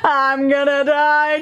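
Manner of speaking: long, drawn-out mock wail; hammed-up "doomed" wail; screaming in panic. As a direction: imitate a slow panicked scream.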